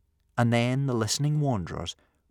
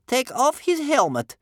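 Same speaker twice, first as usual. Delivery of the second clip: high, loud grandmother command; giving orders; 8 dB louder than usual. A narrator's reading. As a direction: order your helpers sharply. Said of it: "grandmother ordered Wild Thing".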